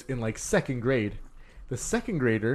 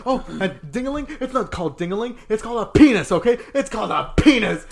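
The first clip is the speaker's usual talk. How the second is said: high, indignant scolding, getting louder; yelling old teacher; 7 semitones higher, 8 dB louder than usual. Retold or imitated. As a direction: correct a child like an outraged old lady. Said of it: "imagine an old lady yelling".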